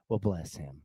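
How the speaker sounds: mock-sweet Southern "Well, bless him" said to mean idiot; church grandma voice. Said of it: "a church grandma or somebody, they're like".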